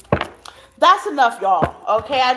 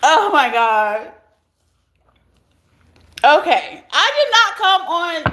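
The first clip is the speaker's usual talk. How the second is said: good tears; 4 semitones higher than usual. Real, not acted. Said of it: "These are good tears".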